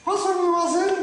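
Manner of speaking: high-pitched puppet echo; puppet repeating. Acted. Puppet copying the greeting to the young ladies, high puppet voice. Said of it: "Tu vas répéter après moi".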